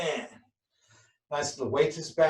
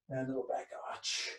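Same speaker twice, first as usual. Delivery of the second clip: breathy near-whisper cue, much softer; 8 dB quieter than usual. Shown as a demonstration. Instructor cueing the class's last stretch, near whisper. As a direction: murmur the cue almost voicelessly.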